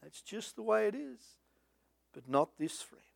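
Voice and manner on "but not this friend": whispered aside to the congregation; hushed. Real, not acted. Preacher whispering to the congregation, hushed. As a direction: drop to a breathy whisper.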